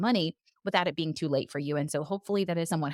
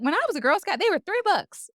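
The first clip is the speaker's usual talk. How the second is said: mock indignation; 11 semitones higher, 5 dB louder than usual. Complaining about inflation.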